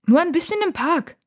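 high-pitched, annoyed retort; irritated. A narrator's reading. Nora brushes off her brother's question, irritated. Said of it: "sagt sie gereizt".

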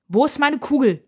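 loud, high demand; snapping angrily. A narrator's reading. Sister confronts crying brother over the globe, snapping. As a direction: snap at him, loud and high. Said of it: "schnauzt sie ihren Bruder an".

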